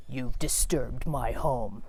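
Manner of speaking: low, slow, eerie spider voice. Pretend. The angry spider speaks to the driver, low and slow. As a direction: menace her slowly from deep down. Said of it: "I hear this voice".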